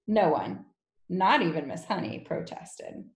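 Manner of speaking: ordinary delivery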